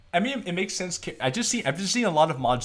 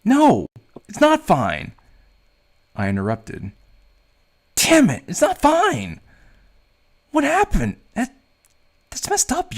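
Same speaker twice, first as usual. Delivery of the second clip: loud, higher, furious ranting; angry outburst; 6 semitones higher, 6 dB louder than usual. Acted. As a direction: yell it out in helpless rage. Character furious over girlfriend's ordeal.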